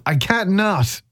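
normal talking voice

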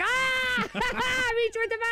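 very high mock shriek, laughing; hammed-up high-pitched drunken holler; rowdy night-out voice. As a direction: squeal it like an hour into drinking. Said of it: "like, an hour later".

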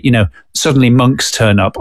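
ordinary talk, nothing marked

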